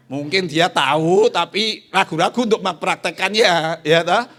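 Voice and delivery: loud, emphatic appeal to the church; rising preacher. Real, not acted. Preacher pressing the congregation, loud and emphatic. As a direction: press the point hard and loud.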